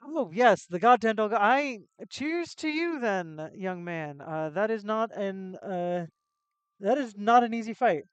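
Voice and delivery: booming, hearty voice; loud gruff townsman. Acted. Townsman toasting the young hero, booming and hearty. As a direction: boom it out like a tavern regular. Character speaking loudly in game.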